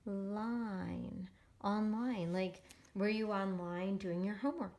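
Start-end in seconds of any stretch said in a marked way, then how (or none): 0.1-1.3 "line" slowly stretched with a long I; syllable sounding-out. Shown as a demonstration. Teacher reading the second syllable, "line" drawn out. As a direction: draw out "line" as a single syllable. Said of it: "I can just read this word".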